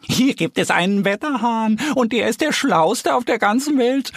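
high-pitched squealing piglet; boastful piglet. A narrator's reading. Pink piglet boasts about the cleverest weathercock, high and proud. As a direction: brag in a high piggy squeal. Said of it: "behauptet ein rosa Ferkel".

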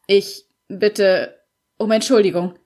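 plain reading voice

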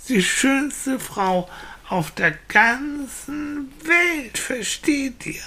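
put-on high, slow, stretched-out childish insistence; insistent little boy. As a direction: spell it out for slow grown-ups. (kleiner Junge, langsam und mit Nachdruck)

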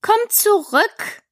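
high, drawn-out call; calling after. A narrator's reading. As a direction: call her back with long syllables. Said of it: "rief die Raupe ihr nach".

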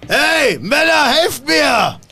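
high, loud, slow shout for help. Acted. NPC bellows to his men for help, high and loud. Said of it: "er brüllt".

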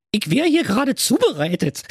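very high she-hedgehog voice, close to giggling; giggly Inge. Acted. She-hedgehog being prepared as food, giggly. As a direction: squeak it, tickled. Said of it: "Was gibt's denn da zu kichern?".

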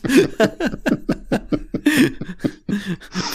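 high, cringing laugh at his own joke; real cringe laugh; embarrassed laughter. Real, not acted.

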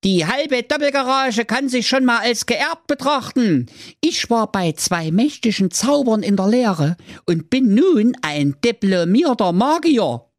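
very high smug son voice; youngest son replying. Acted. Youngest son boasting to father, very high. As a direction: claim the inheritance smugly. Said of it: "Der Klaus drei erwiderte".

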